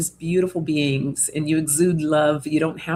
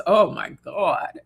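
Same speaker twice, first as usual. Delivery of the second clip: ordinary talk, nothing marked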